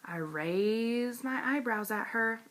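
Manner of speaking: usual speaking voice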